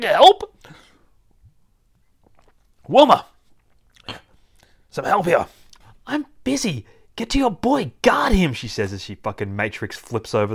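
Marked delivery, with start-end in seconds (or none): 0.0-5.5 high, loud yelps for help; squealing cartoon panic; panicked plea. Tristan cries out for rescue. 6.1-8.5 high-pitched, hard-pressed command; harried heroine, building louder; urgent orders. Acted. Wilma snaps orders mid-fight.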